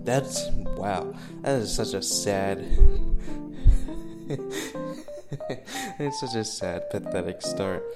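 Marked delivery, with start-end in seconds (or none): 2.6-5.9 sudden high laugh; burst of laughter. Real, not acted. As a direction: break into a laugh. Laughs at own sad start.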